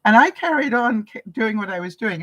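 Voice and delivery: ordinary talk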